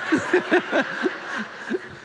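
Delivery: breathless high giggle; high wheezy laugh. Real, not acted. Pastor cracks up reading the article, high wheezy laugh. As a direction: laugh helplessly at the joke. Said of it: "Great article".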